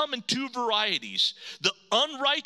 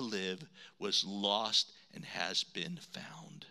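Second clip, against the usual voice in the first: soft, tender pleading, fading to a whisper; gentle father; 9 semitones lower, 4 dB quieter than usual. Acted. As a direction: coax the son softly.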